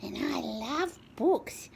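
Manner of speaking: high, cutesy cat voice; cat Oscar saying hello, high and sweet; voicing the cat. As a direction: speak as the cat greeting kids. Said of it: "Saying hello to everybody".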